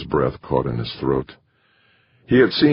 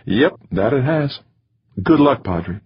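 kind West Texas send-off; warm Texan astronaut; 8 semitones higher than usual. Acted. Astronaut voiced in story.

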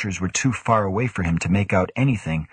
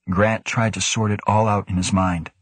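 ordinary delivery

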